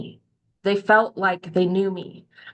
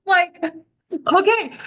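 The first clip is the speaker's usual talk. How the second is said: taken aback; 10 semitones higher, 3 dB louder than usual. Real, not acted. Stung by friends' criticism.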